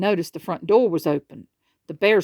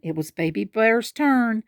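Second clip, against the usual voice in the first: ordinary delivery, nothing marked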